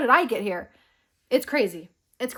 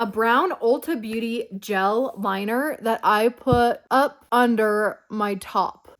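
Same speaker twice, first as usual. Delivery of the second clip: ordinary talk, nothing marked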